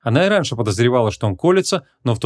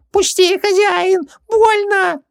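very high, slow, loud genie wail; 4 dB louder, much slower than usual. A narrator's reading. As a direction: howl in pain like a tiny genie. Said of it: "возопил Хубаксис".

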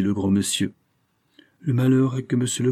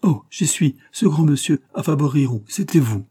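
higher, louder excited cry; sudden realization; 6 semitones higher, 4 dB louder than usual. A narrator's reading. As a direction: blurt out the discovery. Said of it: "s'écria". (exclamation soudaine, plus haute et plus forte)

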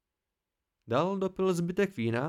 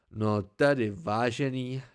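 disgusted; 4 semitones lower, 3 dB louder, slower than usual. A narrator's reading. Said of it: "pravil Arkanik znechuceně".

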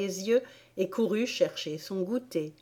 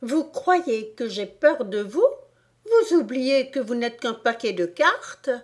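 higher-pitched, defiant challenge; scornful Alice; 5 semitones higher, 4 dB louder than usual. A narrator's reading. Alice scorning the queen and her cards, defiant. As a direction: taunt them with raised pitch.

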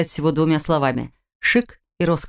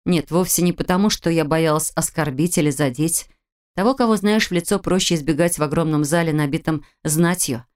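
plain reading voice

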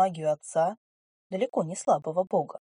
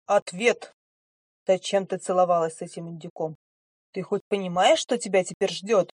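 angry demand; 5 dB louder than usual. Acted. Angry stranger interrogates heroine.

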